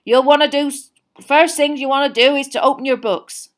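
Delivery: big booming hearty giant; a jolly loud gamekeeper; Hagrid voice. A narrator's reading.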